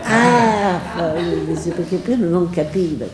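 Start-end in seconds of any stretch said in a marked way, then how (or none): 0.0-0.8 a light laugh breaking through the ah; laughing realization. Retold or imitated. Director catching on with a laugh. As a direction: laugh as it dawns on him.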